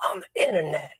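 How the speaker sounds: voiceless whispery rasp; strained, breath-only character voice; damaged vocal cords. Retold or imitated. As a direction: imitate an actor whispering through wrecked cords. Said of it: "the Nick Cage voice the whole".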